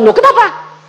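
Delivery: high, loud startled "why?"; shocked protest, high and loud; surprised. Retold or imitated. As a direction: jump up in pitch, caught off guard. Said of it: "Aku bilang".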